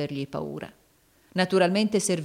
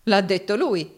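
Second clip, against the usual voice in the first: louder, slightly raised, contemptuous echo; scornful repeat; 4 semitones higher, 6 dB louder than usual. A narrator's reading. Rodolfo echoing Arturo's claim, scornful. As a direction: throw it back with scorn. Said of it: "ripeté Rodolfo sprezzante".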